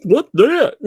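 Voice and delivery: mocking Stark. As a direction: play him dumbfounded and baffled. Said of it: "He was like".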